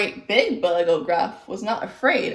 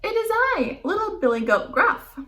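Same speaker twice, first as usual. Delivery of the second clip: little goat voice; 13 semitones higher than usual. Acted. Little goat answers troll.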